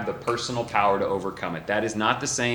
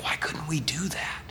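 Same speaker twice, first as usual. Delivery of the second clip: whispered question, softer; private whisper; 5 semitones higher, 7 dB quieter than usual. Retold or imitated. Disciples asking Jesus privately, whispered. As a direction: hush it like a secret asked aside. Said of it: "came to Jesus privately".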